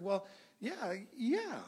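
dazed, high-pitched repeated yeahs; stunned. Retold or imitated. Guest answering a surprise invitation to go, stunned. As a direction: act out a stunned yes, each one swooping high. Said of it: "I was so stunned".

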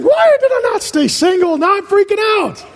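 high, panicky mock worry; a parent panicking, in a high mock-frantic voice; freaking out. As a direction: squeal it like a new parent who regrets everything. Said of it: "You're wondering".

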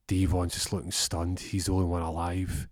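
ordinary delivery

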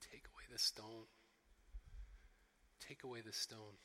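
low, soft and broken; a little choked up. Acted. Deeply moved Jesus before the tomb, a little choked up. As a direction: hold it back, quietly choked with feeling. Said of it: "imagine him being a little bit choked up".

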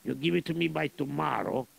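put-on lightly accented Russian general, gruff and greedy. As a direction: play a scheming Russian general. General sets the deal.

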